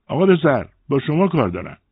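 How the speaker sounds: high-pitched shout to someone in another room; calling out. A narrator's reading. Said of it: "داد زد".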